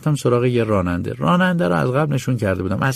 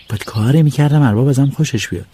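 normal talking voice

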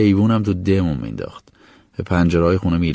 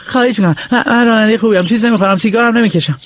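much higher, hurried pleas; boy begging the man on the balcony; desperate begging; 12 semitones higher, 4 dB louder, faster than usual. Acted. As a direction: beg over the gate. Boy pleads at the gate.

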